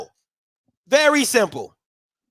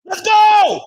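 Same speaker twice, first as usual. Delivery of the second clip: loud high cheering yells; cheering shout; 8 semitones higher, 3 dB louder, much slower than usual. Real, not acted. Fan watching the game, shouting loud cheers. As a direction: yell it out for the team.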